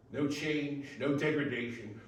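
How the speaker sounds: ordinary talk, nothing marked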